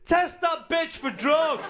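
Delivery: very high, drawn-out loud yell; mock outraged shout. Irish people suspecting their own champion, mock outraged shout. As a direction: shriek the demand, stretching each word. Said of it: "We were going".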